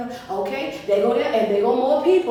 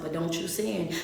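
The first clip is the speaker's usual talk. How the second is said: quiet self-warning; 3 semitones lower, 8 dB quieter than usual. Retold or imitated. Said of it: "our voice then got down a little bit".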